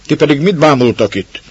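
loud, high scolding yell; the baroness yelling down at the gawking servants; scolding shout. Acted. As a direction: startle the idle servants. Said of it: "riadt a báróné".

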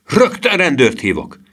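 indignant high yell; yelled threat. A narrator's reading. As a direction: shout a threat at the pursuers. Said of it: "ordította a kemény kalapos".

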